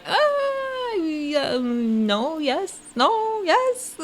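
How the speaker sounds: high, soft, wavering indecision; small high-pitched hemming and hawing; torn, unsure reaction. Acted. Dithering reaction to confessionals.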